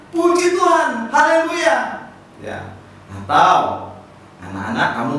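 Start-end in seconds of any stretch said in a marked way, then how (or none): 0.1-2.1 Puji Tuhan, haleluya called out high and loud; joyful praise cry. Shown as a demonstration. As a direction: shout the praise to the kids. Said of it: "kamu mempersembahkan pujian".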